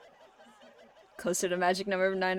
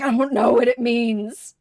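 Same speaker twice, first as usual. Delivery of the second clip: loud, strained quote of a baffled man; acting out overwhelmed confusion; 10 dB louder than usual. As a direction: burst out loudly, mock-overwhelmed. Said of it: "and he's like".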